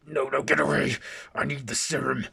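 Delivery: high, loud desperate plea; panicked cry. Acted. Jekyll begging Ava to stay back, loud panicked cry. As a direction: shout them off in panic. Jekyll losing control, voiced by narrator.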